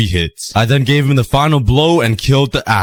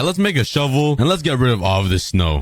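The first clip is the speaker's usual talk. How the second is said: ordinary talk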